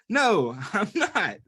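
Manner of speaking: high, mild cry of protest. A narrator's reading. Little voice denying it is a teddy, crying out mildly. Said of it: "cried the voice".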